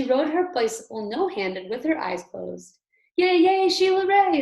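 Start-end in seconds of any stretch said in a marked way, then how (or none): 3.2-4.4 loud, high cheering yell; friends yelling. A narrator's reading. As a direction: cheer her on at full voice. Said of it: "her friends yelled".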